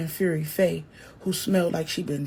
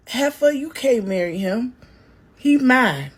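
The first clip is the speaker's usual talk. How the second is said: put-on loud, higher drunken scream; 4 semitones higher, 5 dB louder than usual. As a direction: scream it to stop the wedding. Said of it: "Faye screamed".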